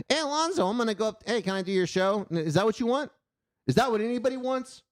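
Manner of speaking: high, mocking impersonation; silly Jacob voice. Showing what Jacob would otherwise sound like, high mock voice. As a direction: pitch it up as a ridiculous alternative. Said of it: "this deep voice fucking impression".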